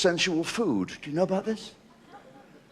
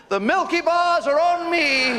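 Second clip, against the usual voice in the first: big, slow, high shout; shouted punchline; 11 semitones higher, slower than usual. Acted. Quoting the advert catchphrase at the audience, shouted. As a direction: bellow the catchphrase.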